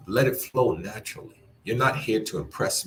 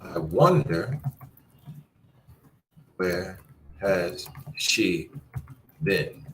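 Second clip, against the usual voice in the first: slow, pausing wonder; slow wondering read; much slower than usual. Shown as a demonstration. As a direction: muse it out word by word. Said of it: "here's another one".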